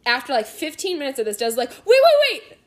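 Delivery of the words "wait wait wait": very high, loud rapid waits; retold urgent outburst; sudden halt. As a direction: cry out to stop everyone. Retold sudden discovery, halting everyone.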